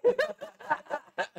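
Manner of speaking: risada aguda e estourada; gargalhada alta. Real, not acted. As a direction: solte uma gargalhada. Riso alto após a história. (gargalhada aguda e estourada)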